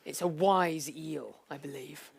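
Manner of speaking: deep wise eel. Acted.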